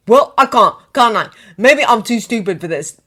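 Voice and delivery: loud, sneering sarcasm; mock him as the clever one. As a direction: Ron sneering at Harry, loud. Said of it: "Ron sneered".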